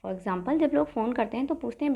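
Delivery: ordinary talk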